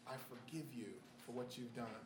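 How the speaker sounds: low, very soft "I forgive you"; quiet forgiveness. Retold or imitated. A grieving woman forgiving the shooter, quiet and low. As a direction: say it softly, with grace. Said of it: "the woman that said".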